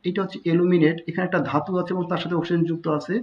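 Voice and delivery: normal talking voice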